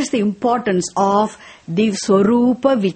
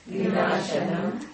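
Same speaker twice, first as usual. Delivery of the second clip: soft repeat; 4 semitones lower, 10 dB quieter than usual. Real, not acted. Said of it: "Say it now".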